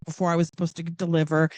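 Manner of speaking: usual speaking voice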